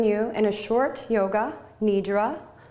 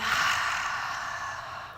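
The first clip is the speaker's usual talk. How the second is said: long, airy sigh out through the mouth; soft exhale; 5 dB quieter than usual. Shown as a demonstration. Yoga teacher showing students the breath, long soft exhale. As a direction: let the breath pour out slowly. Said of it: "Soft exhale through the mouth".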